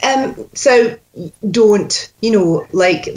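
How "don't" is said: "don't" with a long, pure Scottish O. Shown as a demonstration. Coach showing the sound at the top, Scottish "don't". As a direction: round out the O in "don't", Scottish style. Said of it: "that sound at the top".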